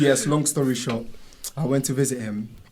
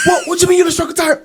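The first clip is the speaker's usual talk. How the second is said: high loud scold; mum's disbelief, raised; mock scolding mum; 6 dB louder, much faster than usual. Mum scolds son for losing track, high loud scold.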